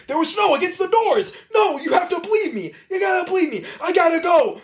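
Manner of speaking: high, fast, laughing panicked plea; frantic Dwight. Retold or imitated. Dwight pleading with the office about the snow, high and frantic. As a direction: squeak out a desperate excuse while cracking up.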